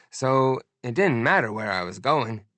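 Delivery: ordinary delivery, nothing marked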